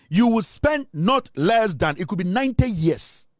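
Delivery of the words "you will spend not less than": "not less than" drawn out slowly; slow quoted warning. Retold or imitated. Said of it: "Ɔsɛ Kyeme Nsa Bonsu ka kyerɛ mo sɛ".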